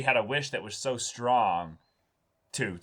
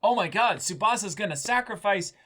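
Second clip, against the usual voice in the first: mock fangirl reaction; 11 semitones higher than usual. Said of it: "I'm like".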